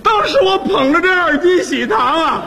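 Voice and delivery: high, drawn-out mock-tearful declamation; moved to tears. Recalling the gift of a casserole, hammed-up tearful gratitude. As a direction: overdo the gratitude, stretching every word high. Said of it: "感动的我是热泪盈眶哦".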